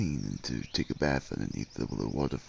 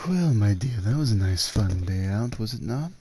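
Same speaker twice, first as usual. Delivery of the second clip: louder and higher, bright and rising; cheerful call; 7 semitones higher, 8 dB louder than usual. Acted. Ending the day out with his dear, cheerfully louder. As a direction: call it out warmly after a good day.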